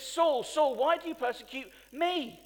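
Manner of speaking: Jesus calling Saul, loud and urgent; booming voice from heaven. Retold or imitated. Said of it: "He says".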